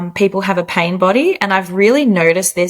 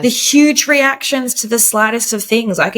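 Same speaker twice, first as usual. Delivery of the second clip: ordinary talk, nothing marked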